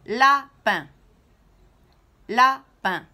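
Lapin scandé haut et très fort, la-pin en deux coups; syllabes frappées. Shown as a demonstration. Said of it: "frapper deux fois dans la main". (maîtresse qui scande Lapin en deux frappes)

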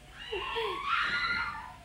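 very high, drawn-out squeal. Pretend. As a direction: let out a sustained, playful squeal. Playful squeal after pretend eating. (高く伸ばした歓声)

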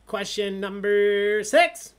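loud, drawn-out build to the number; announcer yell. Real, not acted. Host announcing the next question to players, big yell. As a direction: stretch it out, then yell the number. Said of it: "Sorry, I'm yelling".

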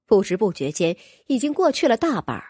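usual speaking voice

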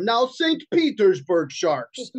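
slow, high mock Russian accent; hammed-up Russian proclamation. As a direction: declare it slowly in a comic Russian accent. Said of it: "I could do a good Russian accent".

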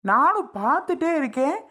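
high-pitched, exasperated scolding; fed-up husband's heated outburst; angry threat. Acted. Husband threatens a slap in quarrel.

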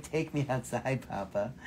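soft, low little dog voice; voicing the dog. Host voices dog asking to go out, soft murmur. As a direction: mumble it like a sleepy pup. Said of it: "he's like".

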